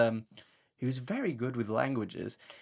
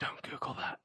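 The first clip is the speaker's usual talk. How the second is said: whisper; 12 dB quieter than usual. Pretend. Aside warning co-host.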